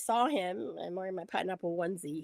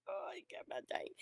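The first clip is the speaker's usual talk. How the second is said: overwhelmed; 11 semitones higher, 13 dB quieter than usual. Retold or imitated. Re-enacts being overwhelmed by hug.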